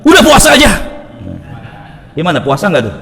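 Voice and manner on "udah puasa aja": high, loud mock-grudging permission; gruff husband's yes. Husband grudgingly allows his wife's fast, loud and mock-annoyed. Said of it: "ngebolehinnya dengan suara yang keras gitu".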